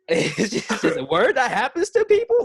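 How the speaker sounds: high, loud words bursting through laughter; helpless laughter. Real, not acted. Guest laughing at co-host's reaction, helplessly cracking up. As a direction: squeal it out while cracking up. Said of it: "making me kinda laugh a little bit".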